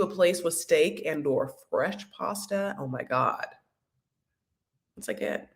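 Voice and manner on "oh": ordinary talk, nothing marked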